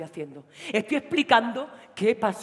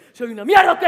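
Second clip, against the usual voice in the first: louder, higher, shouted; angry shout; 7 semitones higher, 14 dB louder than usual. Acted. Boyfriend shouting at his girlfriend, angry. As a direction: throw it at her.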